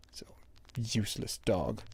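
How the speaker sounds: whispered scorn at the dog; whispered insult. Real, not acted. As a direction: hiss it under your breath. Muttering at dog.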